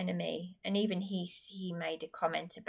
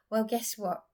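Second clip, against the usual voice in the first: ordinary talk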